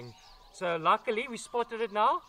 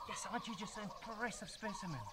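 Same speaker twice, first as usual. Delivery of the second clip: murmured admiringly, much softer; soft fond praise; 12 dB quieter than usual. Real, not acted. Keeper murmurs praise to the python, softly admiring. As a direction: coo over her like a proud keeper. Praising the big snake up close.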